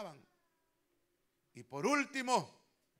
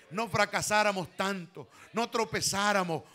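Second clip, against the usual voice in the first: builds from firm to a ringing shout, louder each line; preaching at full force; 10 dB louder than usual. Acted. Pastor to the congregation, preaching louder and louder.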